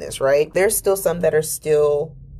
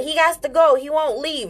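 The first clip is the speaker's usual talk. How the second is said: put-on child's voice; 10 semitones higher than usual. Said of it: "And I said".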